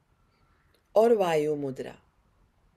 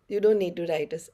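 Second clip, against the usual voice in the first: normal talking voice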